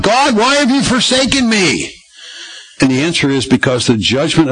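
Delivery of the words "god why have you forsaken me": calling out to God on a much higher pitch; high, pleading cry, acted without extra volume; retold anguished cry. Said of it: "why he's calling out to God".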